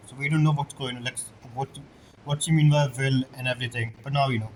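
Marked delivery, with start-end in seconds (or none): none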